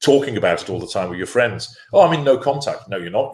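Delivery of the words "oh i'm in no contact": mock breezy claim. Mocking a client's claim.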